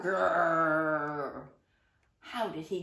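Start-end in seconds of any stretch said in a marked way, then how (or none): 0.0-1.4 low growling grrr; tiger growl. Acted.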